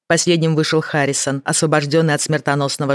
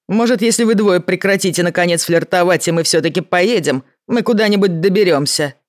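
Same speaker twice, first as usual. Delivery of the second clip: louder, gruff impatient complaint; gruff impatient man; 4 semitones higher, 3 dB louder than usual. Acted. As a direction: grumble at them to hurry. Mancuso snaps at the pair.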